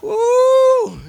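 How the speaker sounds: very high, loud joyful whoop; joyful preacher's whoop; ecstatic woo shout. Real, not acted. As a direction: let out a celebratory woo at the peak.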